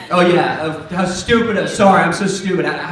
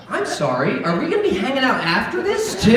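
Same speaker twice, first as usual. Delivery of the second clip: incredulous reply; 7 semitones higher than usual. Retold or imitated. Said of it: "And I was like".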